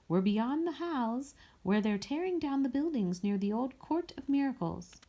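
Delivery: put-on a warm offer to an old friend; inviting. A narrator's reading. Mireille continues invitation.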